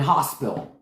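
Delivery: "hospital" squeezed into two syllables, "hos-pill"; North Carolina pronunciation. Shown as a demonstration. Showing family's southern word, "hospital" as "hos-pill". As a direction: drop the middle of "hospital": "hospill". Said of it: "It's not hospital".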